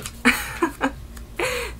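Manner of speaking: light, high laugh; high giggle. Real, not acted. Amused by the note.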